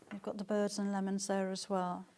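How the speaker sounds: normal talking voice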